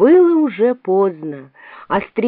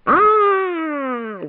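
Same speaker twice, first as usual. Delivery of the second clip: high, drawn-out wail of pain; sustained high "aaah", sliding down; long wailing scream; 10 semitones higher than usual. A narrator's reading. The Queen cries out before pricking her finger, wailing scream. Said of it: "закричала королева".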